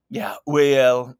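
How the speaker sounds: drawn-out, folksy put-on accent; put-on expat accent. Expat of eighteen months answering why, in put-on accent. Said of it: "accent Bobby has".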